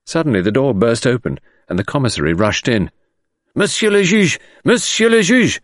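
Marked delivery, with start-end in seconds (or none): 3.5-4.4 high, breathless alarm; loud, high-pitched urgent call. Acted. Commissary bursts in with news. 4.6-5.6 high and loud, a touch louder still; rising urgent cry; urgent call repeated. Acted. Commissary bursts in with news.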